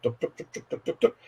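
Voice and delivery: ordinary talk, nothing marked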